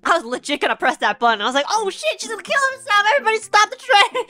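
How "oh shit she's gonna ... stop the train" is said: much higher, rising to a louder panicked call; alarmed. Retold or imitated. Streamer imagining stopping the train for everyone, panicked higher cry. About to press emergency button.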